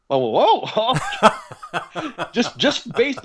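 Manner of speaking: high, laughing disbelief; surprised laugh. Real, not acted. Musician recalling singer's new-record news, surprised laughing. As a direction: laugh it out in happy shock.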